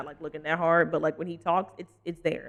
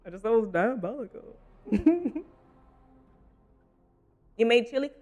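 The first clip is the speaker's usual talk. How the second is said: ordinary talk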